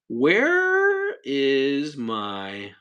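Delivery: very slow, stretched searching words; slow drawn out. Real, not acted. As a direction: drag it out while looking.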